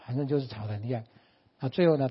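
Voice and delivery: normal talking voice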